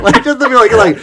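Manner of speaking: normal talking voice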